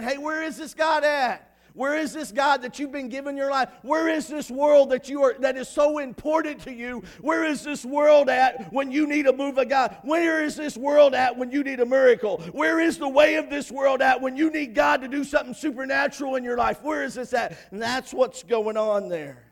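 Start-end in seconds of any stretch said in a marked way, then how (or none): 0.0-1.6 slow, high, loud taunt; mocking. Elijah mocking the prophets of Baal, loud taunt. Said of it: "kinda mocking them a little bit". 1.7-17.6 high, loud, driving rhetorical questions; full-force preaching. Acted. Preacher challenging the congregation about the world, shouted preaching.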